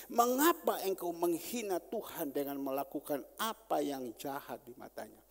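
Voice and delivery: loud, forceful scripture reading; preaching read. Acted. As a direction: proclaim God's words at full force. Preacher reads verse eight aloud.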